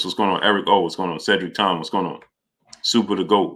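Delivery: ordinary talk, nothing marked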